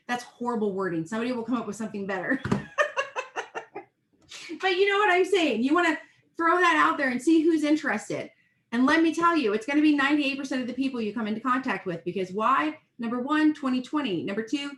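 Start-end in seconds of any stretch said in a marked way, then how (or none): 2.5-3.8 self-conscious laugh. Real, not acted. Laughs at own bad wording. 4.6-11.0 excited. Real, not acted. Energized pep talk.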